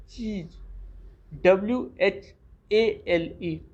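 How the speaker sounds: ordinary talk, nothing marked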